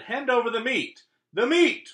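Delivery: very high, loud pirate demand. Acted. Braid Beard demanding meat at dinner, very high and loud. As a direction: demand the meat like a greedy captain. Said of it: "gulped his food and said".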